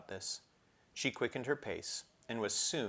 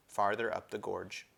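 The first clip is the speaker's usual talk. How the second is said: usual speaking voice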